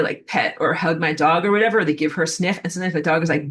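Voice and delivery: normal talking voice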